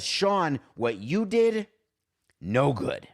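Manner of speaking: usual speaking voice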